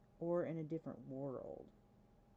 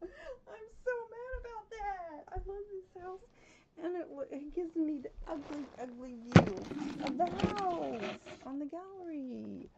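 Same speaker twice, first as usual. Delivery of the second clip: whiny mock crying; 12 semitones higher, 7 dB louder than usual. Upset over ugly gallery view.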